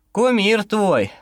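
slow, lazy wry remark; sluggish smirk. A narrator's reading. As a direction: drawl it with a tired smile. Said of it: "вяло улыбнулся Ермилов".

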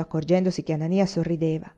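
ordinary delivery

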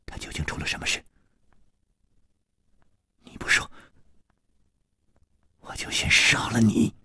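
whispered threat, barely voiced; a whispered threat to make him talk; soft menacing whisper. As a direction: breathe the threat quietly, deadly calm. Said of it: "轻轻地说".

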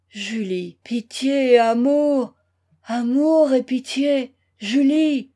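loud, drawn-out cries for pity; hollow-voiced plea. A narrator's reading. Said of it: "lui dit-il d'une voix creuse". (supplique d'une voix creuse)

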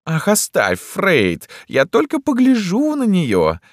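very high, drawn-out whine; affected emperor, dismissive. A narrator's reading. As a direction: wave him off in a theatrical falsetto. Emperor figure dismissing doctor.

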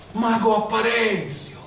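molto più acuto, chiamata meravigliata; esclamazione stupita. A narrator's reading. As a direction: grida il nome con meraviglia. Zosimo chiama stupito il mago. (Zosimo riconosce il mago, esclamazione acuta)

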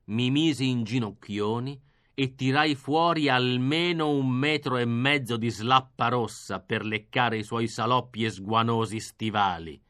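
plain reading voice

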